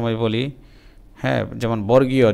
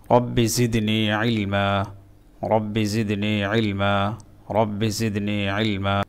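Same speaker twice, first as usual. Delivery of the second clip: slow, soft prayerful chant of the verse; slow recitation; 4 dB quieter, slower than usual. Acted. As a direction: recite it gently, drawing out each word. Recited supplication.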